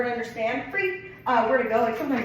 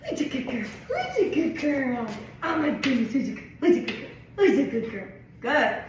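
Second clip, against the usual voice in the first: gushing dog praise; 3 semitones higher than usual. Real, not acted. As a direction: gush over her like a puppy.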